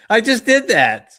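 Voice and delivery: high loud disbelief; realizing with a laugh; amazed realization. Real, not acted. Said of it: "Oh my God".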